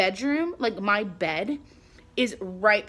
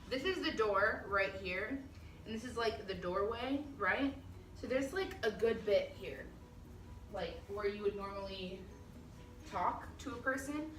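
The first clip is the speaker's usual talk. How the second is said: quiet; 13 dB quieter than usual. Real, not acted. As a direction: keep it down while showing around. Showing the door, filming quietly.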